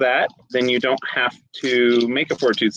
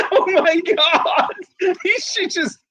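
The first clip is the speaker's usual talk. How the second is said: laughing. Real, not acted. GM laughing at the roll.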